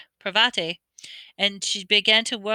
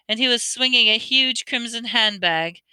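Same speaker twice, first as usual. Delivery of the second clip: ordinary delivery, nothing marked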